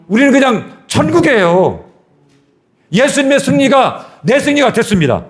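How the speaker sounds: high, ringing pulpit proclamation; full-force preaching. Real, not acted. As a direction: preach it with rising fervor, pitched high.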